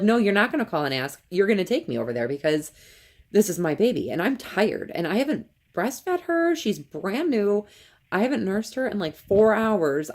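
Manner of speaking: firm, worked-up protest, retold; retold indignant insistence. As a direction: re-enact putting your foot down with the nurse, pitch climbing. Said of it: "And I was mad".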